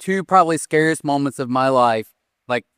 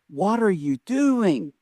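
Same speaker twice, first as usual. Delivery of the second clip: incredulous question; 3 semitones higher, much slower than usual. Retold or imitated. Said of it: "looking at someone and you're like".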